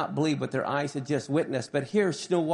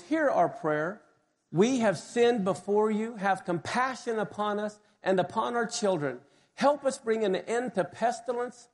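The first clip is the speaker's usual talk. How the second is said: prayer reading; 4 semitones higher than usual. Acted. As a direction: pray the words to the congregation. Reads prayer aloud.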